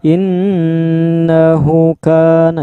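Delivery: demonstrated faulty joining of "innahu kana"; wrong linked reading. Shown as a demonstration. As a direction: chant "innahu kana" in one slow unbroken run. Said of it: "itu salah".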